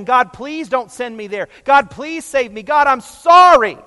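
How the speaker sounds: a condemned man begging not to be sent to hell; begging sinner. Retold or imitated. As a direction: beg God for mercy, voice climbing. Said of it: "kicking and screaming and begging".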